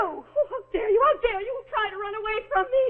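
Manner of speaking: high, loud, crying accusation; tearful fury. Acted. Woman accusing the couple of abandoning her, tearful fury. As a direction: hurl it at them through tears.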